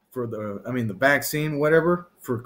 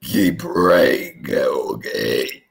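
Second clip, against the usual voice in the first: much lower, slow solemn blessing; deep preacher voice; 11 semitones lower, 3 dB louder, much slower than usual. Pretend. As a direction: intone it like a grave preacher. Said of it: "I don't know what I just said there".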